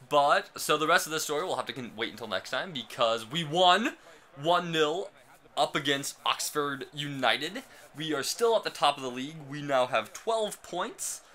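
bursting with real joy over the win; excited. Real, not acted. Thrilled about a football win.

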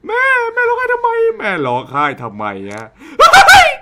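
high shrieking half laugh, half panic; frightened laughing yell. Real, not acted. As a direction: shriek at the crying mom. Mom crying, he freaks. (ตะโกนกลัวปนหัวเราะ)

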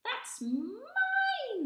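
very high, loud squirrel cry; squeaky squirrel. Acted. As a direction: squeak it out in outrage. Squirrel grabs at spoon.

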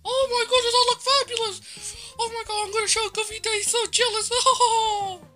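high loud delight ending in a laugh; thrilled. Acted. Mickey admiring his new look in delight, high and loud. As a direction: gush over your own reflection.

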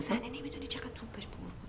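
hushed whisper behind his back; whispered gossip. Acted. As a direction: whisper it so he cannot hear. Gossiping about the angry wife.

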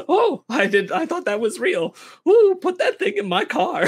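high, loud, flirty gush; flirty ant voice. Retold or imitated. Female ant flirting with a cardboard stand-up, high and loud. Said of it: "And then she says".

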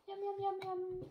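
high, chirped yum-yums. Real, not acted. Mother feeding baby her spaghetti, high playful cooing. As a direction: coo the syllables in a high little voice.

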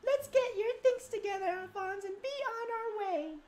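high female character; high falsetto voice. Acted. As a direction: voice her high and gentle. Voicing character in cutscene.

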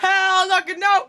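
loud slow hype shout. Pretend. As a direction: yell it like a club host. Club hype call-and-response.

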